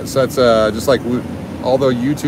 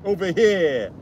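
calling out; 8 semitones higher, slower than usual. Shown as a demonstration. Calling out to Karen.

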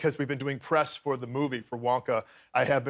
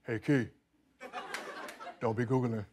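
mumbled warning, spaced out with big gaps; Shaquille O'Neal; 2 semitones lower than usual. Retold or imitated. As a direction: imitate a big basketball star, slightly lowered voice. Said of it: "Shaq goes, he goes".

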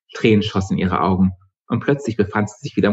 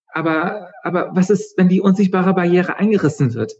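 much higher, stammering worried question; anxious girl; 10 semitones higher than usual. A narrator's reading. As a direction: blurt it out scared. Mitra anxiously asks.

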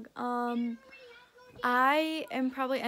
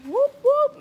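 cheer; 10 semitones higher, 5 dB louder than usual. Real, not acted. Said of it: "Can I get a whoop whoop".

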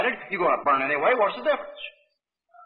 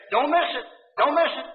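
raised-pitch sales slogan; mock advert hype; 6 semitones higher than usual. Preacher mimics sales slogans for the congregation, mock hype. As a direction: repeat it like a catchy advert line.

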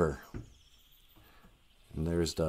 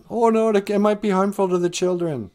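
high, fussy mock fretting; high mock alarm about the children; mock worried voice; 10 semitones higher than usual. Mocking overcautious modern parents.

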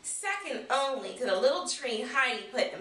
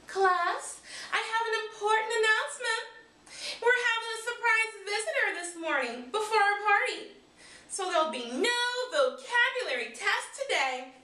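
a hint of a cheerful teacher, higher; teacher's announcement, lightly voiced; 9 semitones higher than usual. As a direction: announce good news to the class.

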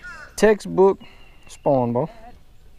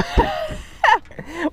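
high laugh. Real, not acted. Friends laughing at fishing joke.

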